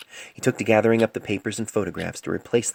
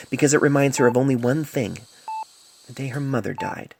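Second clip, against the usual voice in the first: raised, intense voice recalling grief; emotional recollection; 5 semitones higher, 5 dB louder than usual. Acted. Pony telling a doctor about her mother's death, raised and heartfelt. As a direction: push through the memory with feeling.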